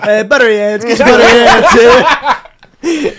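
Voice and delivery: loud laughing shout in a goofy voice; laughing callout. Pretend. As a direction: yell it through laughter, teasing. Goofy callout between friends.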